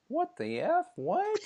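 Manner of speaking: high incredulous what the F; disbelieving, pitch shooting up; baffled. Retold or imitated. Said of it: "I was like".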